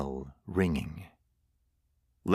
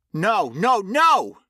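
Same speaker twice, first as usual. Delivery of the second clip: high, loud desperate cry; dying man screaming in denial; panicked screaming; 12 semitones higher, 5 dB louder, slower than usual. A narrator's reading. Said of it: "Lenny screamed".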